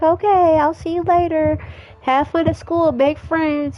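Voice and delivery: high mom voice. Acted. Mom sees son off to school, high cheerful voice.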